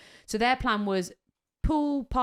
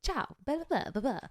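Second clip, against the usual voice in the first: high, babbling mock Italian; badly faked Italian chatter; bad Italian accent; 6 semitones higher, 4 dB quieter than usual. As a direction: put on a silly Italian lilt. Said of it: "I can't do an Italian accent".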